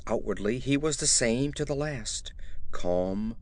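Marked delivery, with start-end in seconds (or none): none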